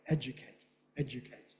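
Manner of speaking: educate as ED-juh-cate, weak middle vowel; schwa in educate. Shown as a demonstration. Lecturer showing North American form, educate with a schwa. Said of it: "North Americans pronounce it that way".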